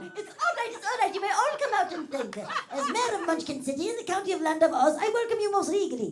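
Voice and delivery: very high, loud squeaky munchkin proclamation; Munchkin mayor. Pretend. Comedian voicing the Munchkin mayor for the slot machine. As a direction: proclaim it like a tiny pompous mayor. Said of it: "sound like the Wizard of Oz".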